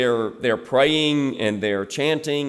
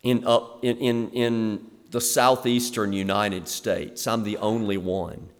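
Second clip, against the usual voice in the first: softer, lower, self-pitying whine; mock lonely martyr's prayer, softer and lower; 6 semitones lower, 5 dB quieter than usual. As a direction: pray like you're the last faithful soul, a bit hammed-up. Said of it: "Have you ever prayed to God that way?".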